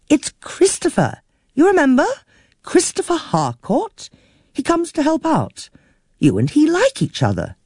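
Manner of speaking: a lightly voiced patient middle-aged niece. A narrator's reading. Niece reminds forgetful aunt who Christopher is, patiently. Jean answers her aunt.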